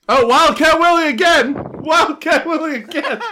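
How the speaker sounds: excited shout of recognition. Real, not acted. Cohost spots the wrestler again.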